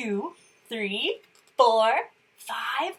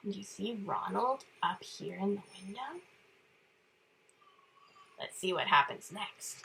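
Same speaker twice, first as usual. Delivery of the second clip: usual speaking voice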